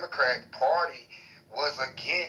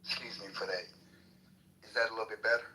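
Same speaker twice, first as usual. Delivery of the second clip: quieter; 4 semitones lower, 6 dB quieter than usual. Real, not acted. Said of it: "I'm gonna try to lower my voice".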